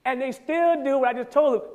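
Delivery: usual speaking voice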